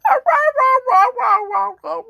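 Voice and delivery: high, loud yappy barks; high mock puppy barking; imitating dog bark. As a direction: yap like a tiny chihuahua. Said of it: "She's like".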